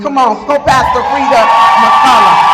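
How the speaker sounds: loud hype call-out; rally the crowd behind her; host shouting praise for the pastor, loud; cheering shout. Real, not acted. Cheering on pastor's church group.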